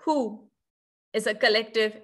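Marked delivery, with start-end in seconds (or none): none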